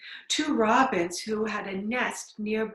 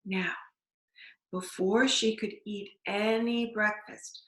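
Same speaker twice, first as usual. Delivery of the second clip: plain reading voice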